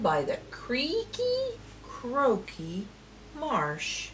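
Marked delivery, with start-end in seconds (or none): none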